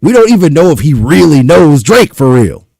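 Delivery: loud, emphatic protest; indignant and forceful; exasperated. Real, not acted. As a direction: raise your voice in disbelief. Exasperated rant at the clip.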